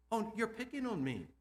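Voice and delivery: student protesting to the teacher, lightly whiny. Acted.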